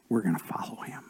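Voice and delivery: soft whispered resolve. Real, not acted. Pastor whispering the three men's vow to the king. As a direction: breathe it out quietly.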